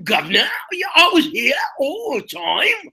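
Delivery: a bad Artful Dodger Cockney, cracking up. Asked by the hosts, a bad Cockney Artful Dodger impression. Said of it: "my bad Artful Dodger".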